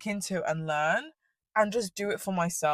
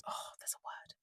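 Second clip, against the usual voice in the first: barely voiced whisper to oneself; whispered aside; 19 dB quieter than usual. Real, not acted. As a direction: whisper it while groping for a word. Searching for a word, to herself.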